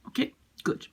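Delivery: soft unvoiced "Okay, good"; whispered aside. Real, not acted. Teacher quietly closing the point. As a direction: breathe it quietly.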